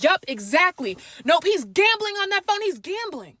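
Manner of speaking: mom imitated high and loud; mom's voice. Mom telling on the brother, high and loud. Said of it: "My mom's like".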